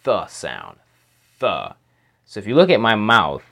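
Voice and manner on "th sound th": soft th with tongue between teeth; th sound demo. Shown as a demonstration. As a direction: breathe out a soft th. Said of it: "let's practice just making the th sound".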